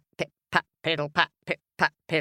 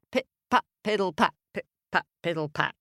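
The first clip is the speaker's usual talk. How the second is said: plain reading voice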